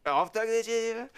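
high, drawn-out mock French; hammed-up French pronunciation; bad French accent. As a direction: slowly overdo a French accent. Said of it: "sound like you have the right dialect".

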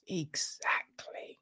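put-on slow, hushed whisper to small children; soft, conspiratorial teacher's whisper; whispered agreement. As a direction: whisper it, stretching the word out. Teacher praising the children's answer, acted.

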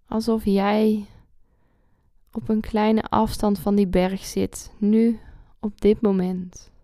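calm guide with long pauses; guided meditation. Acted.

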